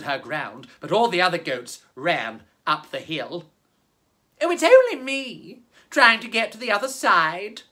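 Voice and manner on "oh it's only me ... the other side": a hint of a higher, timid little goat; lightly voiced goat. A narrator's reading. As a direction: lightly play the nervous goat talking her way past. Goat Milly answers the troll.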